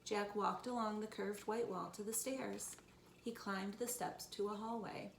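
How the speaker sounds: ordinary delivery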